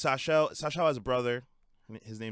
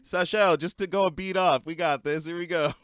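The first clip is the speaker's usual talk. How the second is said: mock count-in; 6 semitones higher, 5 dB louder than usual.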